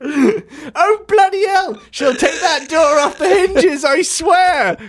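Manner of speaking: high-pitched panicked shout; Jimmy crying out. Acted. Jimmy warns the others as the door bows, crying out. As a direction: cry out in a very high put-on voice. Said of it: "cries Jimmy".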